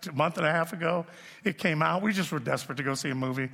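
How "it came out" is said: normal talking voice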